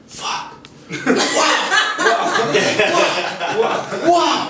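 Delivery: laughing, high-pitched wows of wonder; retold amazement. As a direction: relive the shock of meeting the world. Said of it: "I was like".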